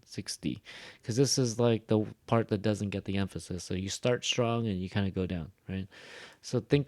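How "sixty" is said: "sixty" low and soft, dropping at the end; falling sixty. Shown as a demonstration. Teacher showing students how "sixty" goes down. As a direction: let "sixty" sink down. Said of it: "It goes down".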